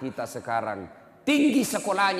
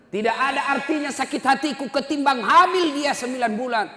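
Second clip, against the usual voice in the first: much louder and higher pleading cry; anguished cry; 12 semitones higher, 8 dB louder than usual. Retold or imitated. Mother cries out to the Prophet about her pregnancy, loud and high. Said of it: "ibunya berteriak".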